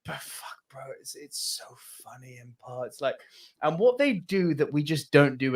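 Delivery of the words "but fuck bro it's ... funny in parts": whispered confession; hushed, whispered aside as if admitting a secret; guilty admission. Real, not acted. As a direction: drop to a voiceless whisper. Guilty admission, voice drops away.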